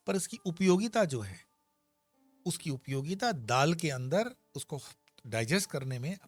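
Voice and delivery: ordinary talk, nothing marked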